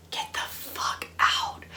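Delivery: slow, hushed whisper of disbelief; stunned whisper. Real, not acted. Reader stunned by the dreamer line, awed whisper. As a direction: whisper it, drawn out and amazed.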